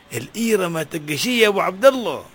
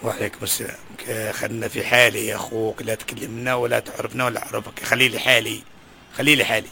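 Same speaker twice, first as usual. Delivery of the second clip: a low, muttered brush-off; lightly voiced, tell him to leave you be; ashamed beggar; 8 semitones lower than usual. Beggar to an old friend, low embarrassed brush-off.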